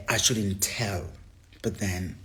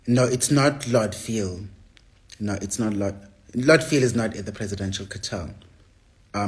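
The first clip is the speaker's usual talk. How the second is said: ordinary delivery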